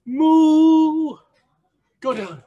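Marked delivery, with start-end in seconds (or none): 0.1-1.2 loud, high, long moo; cow moo. Shown as a demonstration. Coach leading animal-noise push-ups, big loud moo. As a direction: belt out a sustained cow moo. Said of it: "other animal noises".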